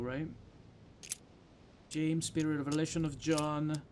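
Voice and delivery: normal talking voice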